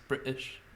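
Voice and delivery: "British" with the T sounds swallowed; British without Ts. Shown as a demonstration. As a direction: say "British" with glottal stops instead of T. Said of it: "you say it with no T's".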